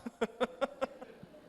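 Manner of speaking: real voiceless, high chuckling; breathy laugh. Real, not acted. Preacher amused that coworker starts to cry, breathy laugh. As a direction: let out an airy run of chuckles.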